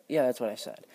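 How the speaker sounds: ordinary talk, nothing marked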